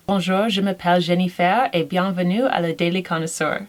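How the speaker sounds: a French greeting in a French accent; speaking French. Shown as a demonstration. Greeting viewers in French as a sample, light French accent. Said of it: "say a sentence".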